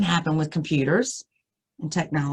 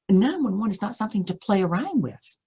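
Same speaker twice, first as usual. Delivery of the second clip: ordinary delivery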